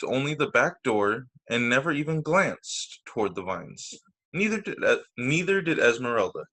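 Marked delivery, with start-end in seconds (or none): none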